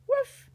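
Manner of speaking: a bright yappy puppy bark; puppy woof. Pretend. Reading to a child, playful high puppy woof. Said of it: "puppy barks one little bark".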